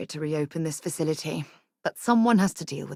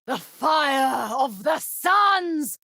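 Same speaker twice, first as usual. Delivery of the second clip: slow, drawn-out high shout; battle cry; 9 semitones higher, 4 dB louder, much slower than usual. Acted. Charging into combat beside the captain, a slow battle cry. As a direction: roar it like a war cry.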